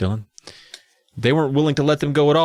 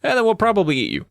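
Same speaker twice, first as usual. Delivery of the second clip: casual gnome voice; 7 semitones higher, faster than usual. Retold or imitated. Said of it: "the gnomes are like".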